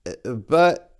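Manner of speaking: high stammering bird voice. Acted. Big bird stammering as she is sent out. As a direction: stumble over the words, lost. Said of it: "the big bird stammered".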